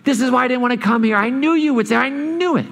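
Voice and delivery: mock exasperated, climbing high on I knew it; petulant prophet griping at God; whiny complaint. Jonah complaining to God about mercy, whiny. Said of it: "this is what he says".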